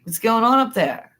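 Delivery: retold call to figures in a tree. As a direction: call up to them, amused. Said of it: "I'm like".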